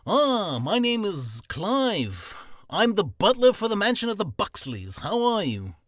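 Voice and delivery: a stuffy mansion butler greets a guest. Acted. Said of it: "something as clever as".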